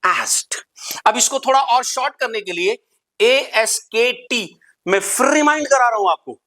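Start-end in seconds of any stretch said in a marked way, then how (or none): 0.0-0.6 "asked" said with a final t sound, askt; correct pronunciation. Shown as a demonstration. Teacher showing students "asked" ending in t. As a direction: end "asked" on a crisp t, not d. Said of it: "the right pronunciation is".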